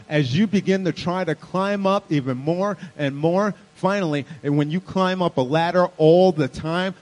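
loud, high, driving sermon voice; preaching at full force. Acted. Pastor rallying the congregation, loud, driving preaching. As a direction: preach it out to the whole room.

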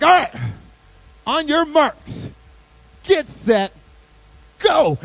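very loud, drawn-out starting call; acted full-volume race start; race starter. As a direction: shout each command with a pause after it.

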